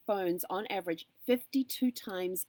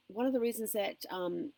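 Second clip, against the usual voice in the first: ordinary delivery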